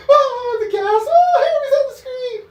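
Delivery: high, loud fake sobbing; wailing overexcited fan; mock crying fan. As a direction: ham up a fan weeping with joy. Mocking fans crying at movie.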